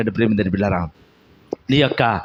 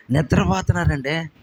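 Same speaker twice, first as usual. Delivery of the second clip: ordinary talk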